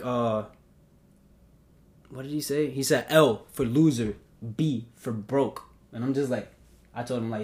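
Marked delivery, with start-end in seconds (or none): 3.1-5.6 slow mocking retold jab; deliberate, drawn-out mock spelling; spelled-out taunt. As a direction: imitate a taunt, letter by letter.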